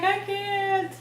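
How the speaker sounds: high, mock-crying wail; hammed-up weepy protest; imitated tearful refusal. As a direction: whine it as if in tears. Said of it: "I just can't stop crying".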